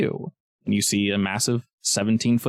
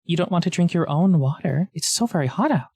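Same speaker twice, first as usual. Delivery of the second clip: higher-pitched snake voice, feigned concern; coaxing snake; 6 semitones higher than usual. Acted. Snake tempts adventurer to drink, higher coaxing voice. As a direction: coax like a sly talking snake.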